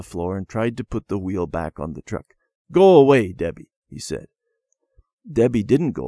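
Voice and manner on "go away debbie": loud order, dropping on the name; cross dismissal. A narrator's reading. Randy sending Debbie away from the truck, cross. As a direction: send her off loudly and crossly.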